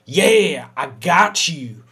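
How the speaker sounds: loud, taunting gloat; loud mocking victory taunt; gloating bear. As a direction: rub it in, loudly. Bear gloating over you.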